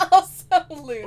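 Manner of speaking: laughing, loud and high, echoing "Also, loons.". Retold or imitated. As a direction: repeat "Also, loons." through a big laugh.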